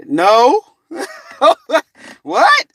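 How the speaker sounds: high, loud laughing refusal; laughing denial. Retold or imitated. Man refusing the vision, laughing. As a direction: laugh it off in disbelief.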